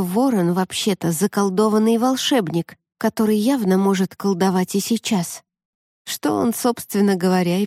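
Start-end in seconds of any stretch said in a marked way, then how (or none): none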